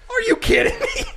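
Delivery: high, loud laughing disbelief; stranded man crying out in mock disbelief; disbelieving. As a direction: act out shocked exasperation. Hanks stranded, disbelief retold.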